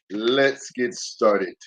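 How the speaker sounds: normal talking voice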